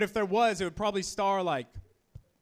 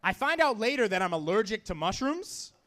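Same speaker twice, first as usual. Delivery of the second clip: usual speaking voice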